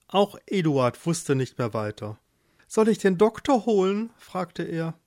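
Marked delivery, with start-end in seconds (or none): 2.7-4.1 higher, anxious offer; worried husband. Acted. Eduard asks his ailing wife, worried. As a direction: offer help urgently.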